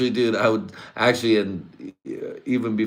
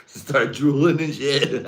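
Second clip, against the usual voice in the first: ordinary talk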